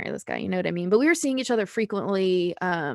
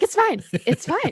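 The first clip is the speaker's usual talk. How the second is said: high nervous it's fine; high-pitched repeat; 10 semitones higher than usual. Retold or imitated. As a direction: squeak it twice as if calming yourself. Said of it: "the pitch in my voice went up".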